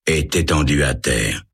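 usual speaking voice

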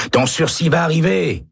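high encouraging call. A narrator's reading. Jorgensen calling out to Baca, high and hopeful. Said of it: "crie Jorgensen".

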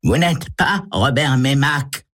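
high female accuser's voice; woman's voice. A narrator's reading. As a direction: throw out a high-pitched denial as a woman. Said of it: "lance une voix de femme derrière lui".

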